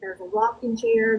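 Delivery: usual speaking voice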